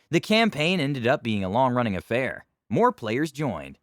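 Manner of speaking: usual speaking voice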